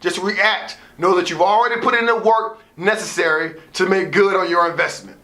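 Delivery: ordinary talk, nothing marked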